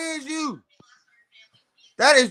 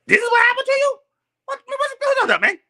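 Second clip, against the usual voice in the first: much higher, incredulous squeal; higher mock outrage; mock disbelief; 14 semitones higher, 4 dB louder than usual. Real, not acted. Incredulous mocking.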